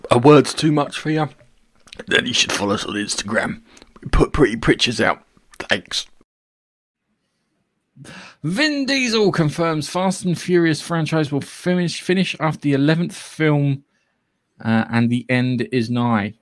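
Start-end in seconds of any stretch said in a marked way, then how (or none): 0.1-6.1 promo read. Acted. Reads a promo plug. 8.4-13.8 news headline read. Acted.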